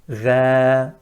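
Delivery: Ra thin, falling sound; Ra without heaviness; light Ra. Shown as a demonstration. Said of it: "sound is going downwards".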